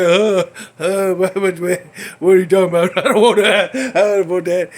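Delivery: half-laughing, half-frightened refusal; scared laughing protest. Retold or imitated. Told a demon was here, he protests loudly through nervous laughter.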